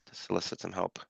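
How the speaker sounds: usual speaking voice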